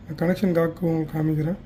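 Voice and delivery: ordinary talk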